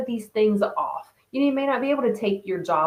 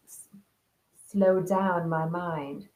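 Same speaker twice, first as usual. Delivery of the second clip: ordinary talk, nothing marked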